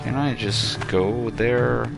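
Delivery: normal talking voice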